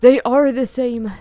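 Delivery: loud, slow excited cry; excited exclamation. A narrator's reading. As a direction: burst out with excitement. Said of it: "exclaimed Joseph excitedly".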